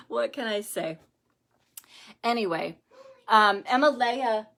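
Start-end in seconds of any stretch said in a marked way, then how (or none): none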